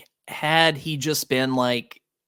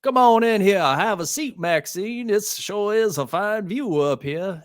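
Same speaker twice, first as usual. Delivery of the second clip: put-on Deep South accent; 8 semitones higher than usual. Mocking the character she dislikes, in a put-on Deep South accent. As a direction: lay on a hammy New Orleans accent for the villain. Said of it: "like, Deep South accent".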